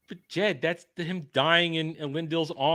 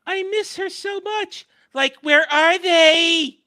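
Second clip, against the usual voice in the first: high, slow, whiny mock fan voice; 14 semitones higher, 7 dB louder, slower than usual. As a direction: whine it like a lovesick fan. Mocking a fan again.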